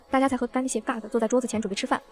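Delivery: ordinary delivery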